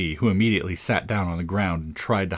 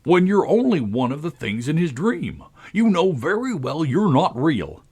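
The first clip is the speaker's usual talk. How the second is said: pompous, pitched-up put-down; taunting Tweedledum; 8 semitones higher, 4 dB louder than usual. Acted. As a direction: needle her in a raised character voice.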